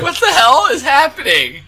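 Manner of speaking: high, laughing what-the-hell; laughing disbelief. Retold or imitated. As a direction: squeal it through a laugh. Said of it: "I'm like".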